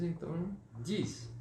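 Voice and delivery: usual speaking voice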